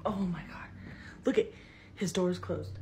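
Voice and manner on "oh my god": hushed and spooked; scared whisper. Real, not acted. Filming alone at night, whispering scared. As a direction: whisper it, frightened, so nothing hears.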